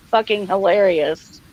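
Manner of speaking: ordinary talk, nothing marked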